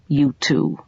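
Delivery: usual speaking voice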